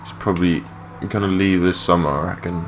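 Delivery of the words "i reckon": ordinary talk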